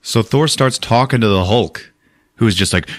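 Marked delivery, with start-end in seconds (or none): none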